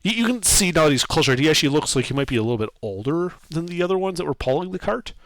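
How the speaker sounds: normal talking voice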